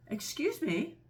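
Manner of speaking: put-on high, polite young elephant voice; polite child. Elephant's child excuses himself to the crocodile, high and polite. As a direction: pipe up politely, high-pitched. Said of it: "said the elephant's child most politely".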